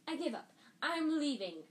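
storming out. Acted. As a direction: fling it at the teacher. Hermione storming out.

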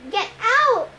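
high urgent shout; yelled. A narrator's reading. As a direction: order him out now. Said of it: "Annabeth yelled at me".

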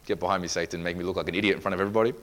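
low, fast, soft muttered rebuke; mock rebuke. Preacher acting out his doubting reply to God, mock rebuke. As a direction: mutter it quickly, dismissing the voice.

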